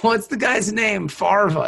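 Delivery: ordinary delivery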